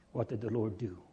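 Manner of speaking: normal talking voice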